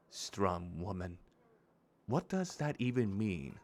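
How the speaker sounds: soft, lightly voiced grieving widow; understated, hurt character voice; bereaved strong woman. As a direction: read her quietly, with wounded restraint.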